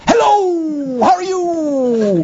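high, slow, drawn-out theatrical hello; Shakespearean actor. Retold or imitated. Actor greeting a friend over tea, grandly theatrical. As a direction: stretch the greeting like a stage actor. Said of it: "training in Shakespearean".

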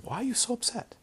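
high, gentle questioning; concerned questioning. Retold or imitated. Retells consoling a crying student.

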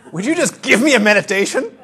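imitating a student getting madder, loud and high; exasperated demand. Lady pestering her teacher for a meditation, imitated exasperated demand. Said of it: "she's getting madder and madder".

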